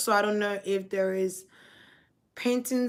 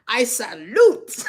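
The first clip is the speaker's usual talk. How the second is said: proud salute; 3 semitones higher, 11 dB louder, slower than usual. Retold or imitated. Spirit's salute relayed laughing.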